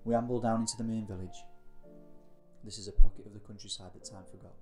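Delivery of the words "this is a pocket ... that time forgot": ordinary talk, nothing marked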